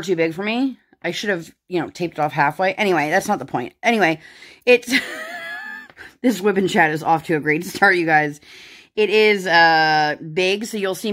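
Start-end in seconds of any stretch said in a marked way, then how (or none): 5.0-6.2 high burst of laughter; quick squeaky laugh. Real, not acted. Laugh at messy start.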